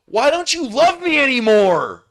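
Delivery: high, loud whine of a clingy ex; whiny pleading ex. Retold or imitated. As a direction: whine it like a jilted lover. Ex begs at the gate.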